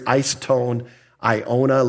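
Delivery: eis ton aiona spoken slowly and carefully; for the flock, eis ton aiona spelled out slowly; Greek phrase. Shown as a demonstration. Preacher teaching the Greek phrase, slowly and carefully. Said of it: "It's the phrase".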